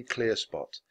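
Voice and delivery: plain reading voice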